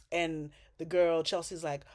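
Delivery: usual speaking voice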